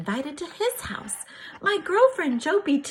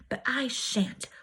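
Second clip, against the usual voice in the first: breathy secret whisper, slowed down; a stubborn whisper to herself, not for grandmother's ears; whispered defiance; 6 dB quieter than usual. Acted. Girl whispering her refusal of grandmother's wishes, hushed.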